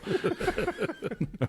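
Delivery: light high giggle; short burst of high, soft laughter. Real, not acted. Host laughing at being bad at this, soft high giggle. Laughs after self-deprecating remark.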